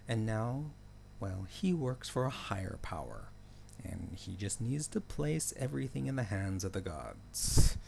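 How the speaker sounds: ordinary delivery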